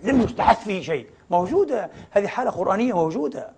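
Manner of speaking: normal talking voice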